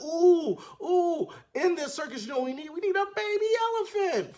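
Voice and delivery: high, giggly scheming voice; scheming headmaster. Retold or imitated. Headmaster pitching a baby elephant, high and giggly. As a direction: cackle through your big idea. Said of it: "He's like".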